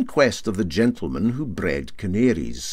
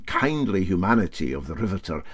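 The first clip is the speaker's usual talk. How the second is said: plain reading voice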